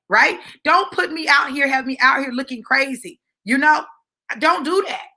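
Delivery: plain reading voice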